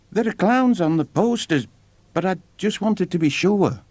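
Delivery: put-on a small boy checking hopefully; young boy. A narrator's reading. William asks the ringmaster about clowns, high and earnest.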